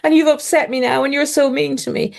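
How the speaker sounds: high, lightly tearful imitation of a wounded mother; imitating a mother turning on the tears; mock tearful. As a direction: pretend to well up, pitched high. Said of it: "that's when the tears would come".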